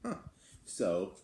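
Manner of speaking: ordinary delivery, nothing marked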